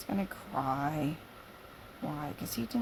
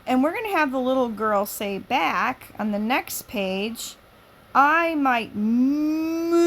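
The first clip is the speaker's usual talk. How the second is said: loud high read-aloud, slowed on the spelling; lively story reading; 4 semitones higher, 13 dB louder, slower than usual. Acted. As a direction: spell MOOVE out slowly and loud.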